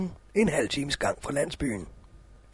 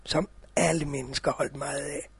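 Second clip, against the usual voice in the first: soft cosy storytelling; 3 semitones lower, 4 dB quieter than usual. A narrator's reading. Opening of a read fairy tale.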